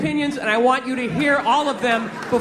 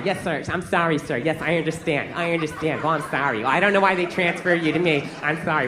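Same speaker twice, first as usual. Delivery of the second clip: low, monotone customer-service apology; apologetic phone agent; 8 semitones lower than usual. Retold or imitated. Phone agent soothing an angry caller, endlessly sorry. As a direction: placate the caller in a low, droning voice. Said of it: "they're always sorry".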